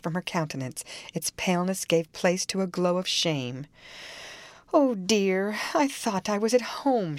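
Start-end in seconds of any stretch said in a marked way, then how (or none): 4.7-7.2 sighing. A narrator's reading. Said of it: "she sighed".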